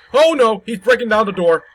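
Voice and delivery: loud, scared cry as the door breaks; panicked shout. Real, not acted. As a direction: yell it in real panic.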